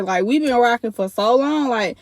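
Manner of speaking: usual speaking voice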